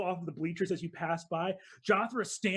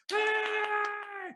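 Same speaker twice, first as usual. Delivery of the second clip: crowd cheering; 14 semitones higher than usual. Acted. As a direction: call out the champion to the stands. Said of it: "you see people go".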